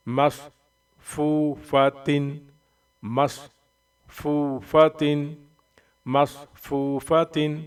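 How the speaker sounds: مصفوفتن stretched slowly with a nasal -tin at the end; slow tanween drill. Shown as a demonstration. Teacher modelling مصفوفتن for students, slow and careful. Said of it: "Quran reading drill".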